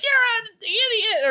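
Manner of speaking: hammed-up screaming at the taxi driver; mock shriek. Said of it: "screaming at the taxi driver".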